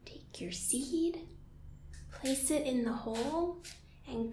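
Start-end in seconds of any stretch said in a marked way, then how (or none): 0.0-1.2 breathy unvoiced whisper to the kids. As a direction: hush it like a secret. Whispered growing story.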